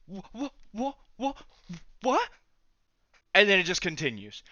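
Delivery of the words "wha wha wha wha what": confused stammer. Retold or imitated. Confused at being asked.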